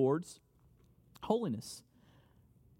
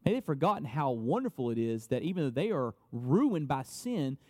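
ordinary delivery

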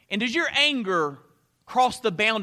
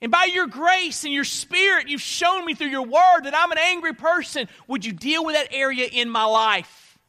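raised, high-pitched pleading prayer; crying out to God; 8 semitones higher, 6 dB louder than usual. Acted. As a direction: cry out loudly to God for help. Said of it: "you cry out to God".

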